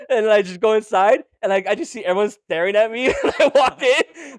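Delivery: ordinary delivery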